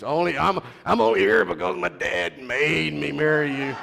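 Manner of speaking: hammed-up son needling his wife; mock son in an argument. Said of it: "throw that up in her face".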